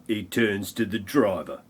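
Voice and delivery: ordinary talk, nothing marked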